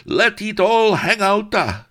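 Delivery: high, loud party whoop from a fat viceroy; cried out. Acted. Viceroy dancing wildly, high loud whoop. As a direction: whoop it up mid-dance.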